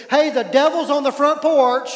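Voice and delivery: loud startled announcement; a friend opens the door to her enemy, loud and startled; shocked alarm. Retold or imitated. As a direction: blurt it out like you've seen the devil himself.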